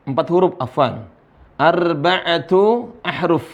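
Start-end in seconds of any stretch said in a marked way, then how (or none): 1.6-3.4 slow enunciation. Shown as a demonstration. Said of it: "Pelan-pelan saja".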